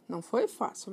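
usual speaking voice

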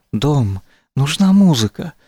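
ordinary delivery

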